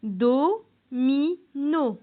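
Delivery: "Domino" very slowly split do-mi-no; syllable by syllable. Shown as a demonstration. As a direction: sound out "Domino" with pauses between syllables. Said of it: "c'est dans la première syllabe". (« Domino » découpé lentement en trois syllabes)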